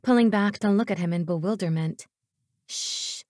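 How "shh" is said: an unvoiced shh, barely breathed; soft paranoid shush; hushing. A narrator's reading. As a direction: hush someone urgently. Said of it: "He hushed me".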